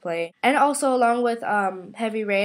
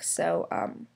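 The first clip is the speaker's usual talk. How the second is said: ordinary delivery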